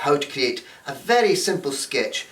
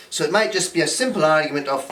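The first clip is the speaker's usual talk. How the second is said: usual speaking voice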